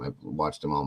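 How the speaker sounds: ordinary talk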